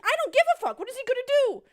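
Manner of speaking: high, loud, acted-out defiance; defiant retort. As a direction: toss it back loudly, voice shooting up. Retells defiant answer to boyfriend.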